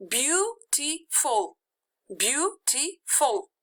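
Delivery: beautiful, drawn out slowly, syllable by syllable; teacher modelling beautiful slowly twice; slow syllable demo. Shown as a demonstration. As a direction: spell out beau-ti-ful by ear, slowly. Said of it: "It's three syllables".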